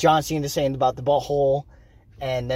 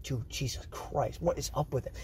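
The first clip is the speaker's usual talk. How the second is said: uncomfortable half-whisper; 4 semitones lower, 13 dB quieter than usual. Retold or imitated. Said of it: "I'm like".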